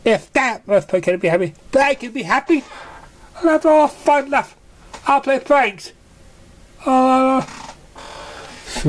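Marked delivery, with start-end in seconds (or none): 0.0-7.4 high, bubbly pony voice; a giddy filly dreaming of fun; high cartoon pony, eager and bouncy; excitable young pony.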